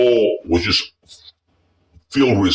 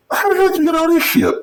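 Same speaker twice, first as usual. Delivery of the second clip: much higher and fast, mock disbelief; retold astonished outburst; faster than usual. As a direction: squeak it out in shocked disbelief. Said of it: "I said".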